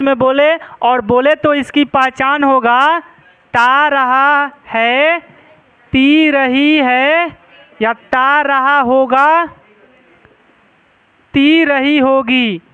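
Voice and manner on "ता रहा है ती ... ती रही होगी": slow dictation. Shown as a demonstration. Teacher dictating tense markers.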